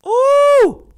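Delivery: high, loud ooh of sudden shock. Real, not acted. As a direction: gasp it out, really shocked. Shocked by detail in news clip.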